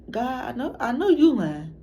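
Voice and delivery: high-pitched, retold disbelief; incredulous reaction. Acted disbelief at a teacher.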